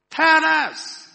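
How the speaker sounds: high, very loud demand; shouted demand. A narrator's reading. Third cave dweller to the silent stranger, shouted demand. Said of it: "demanded a third".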